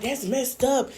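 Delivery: mock his sulky complaint; imitating Chef D complaining. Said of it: "He's like".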